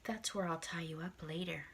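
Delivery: low, breathy creepy whisper. Retold or imitated. Date hints at tying her up, whispered. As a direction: leer it under your breath. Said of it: "And he goes".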